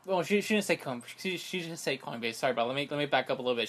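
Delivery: usual speaking voice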